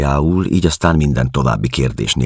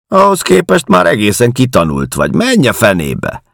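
high, loud and scornful; angry dismissal; 8 semitones higher, 7 dB louder than usual. A narrator's reading. Woman telling the intruder to leave, angry. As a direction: send him packing, voice raised. Woman sends him away angrily.

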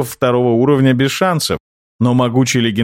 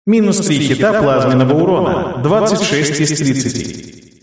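system message voice; 2 semitones higher than usual. A narrator's reading. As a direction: read it like a game interface.

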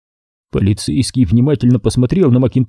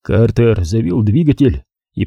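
plain reading voice

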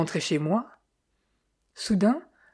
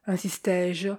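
ordinary delivery, nothing marked

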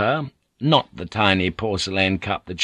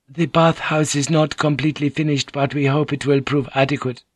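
Mariko's voice; 6 semitones higher than usual. Acted. Mariko greets Blackthorn, higher courteous lady's voice.

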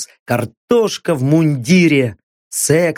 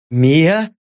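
cautious call. A narrator's reading. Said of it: "осторожно позвал он".

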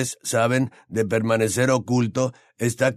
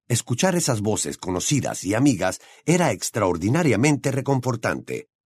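ordinary delivery

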